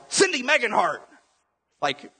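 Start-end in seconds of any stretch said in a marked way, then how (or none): 0.1-1.1 high, loud mock yell of a name. Pastor smashing his thumb with a hammer, high loud mock yell. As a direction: shout the name like you just smashed your thumb. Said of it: "and just yell".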